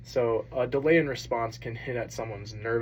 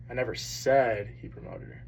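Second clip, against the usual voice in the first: "said" punched, rest falling away; stress on said. Shown as a demonstration. Student presenter showing the class how emphasis shifts meaning, stress on "said". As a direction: lean hard on the word "said". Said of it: "you emphasize different words in that sentence".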